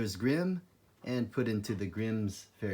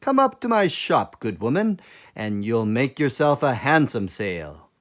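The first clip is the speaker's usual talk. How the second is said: calling out; 8 dB louder than usual. Acted.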